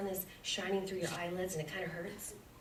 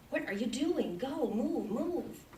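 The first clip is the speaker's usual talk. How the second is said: high, pleading urging, climbing higher; sweet urgent call; 6 semitones higher, slower than usual. Retold or imitated. Spirit urging her every day, high and sweet. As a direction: urge her on sweetly but insistently. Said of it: "spirit was screaming to me in a sweet voice".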